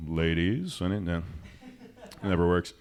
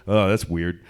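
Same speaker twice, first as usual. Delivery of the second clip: ordinary talk, nothing marked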